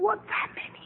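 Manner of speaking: breathless high whisper of praise. Retold or imitated. Praising on the balcony at dawn, hushed whisper. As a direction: whisper so the neighbours can't hear. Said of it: "我就很小声的在那说".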